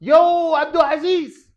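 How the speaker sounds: high, loud call to someone far off. Shown as a demonstration. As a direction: shout the name across the yard. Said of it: "ونريد أن نستعمل حرف النداء". (نداء بصوت مرتفع)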